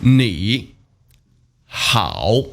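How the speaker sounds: 你 and 好 apart, each dipping low; separate third tones. Shown as a demonstration. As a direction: say 你, pause, 好, each falling then turning up. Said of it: "pronounced in the third tone when spoken individually".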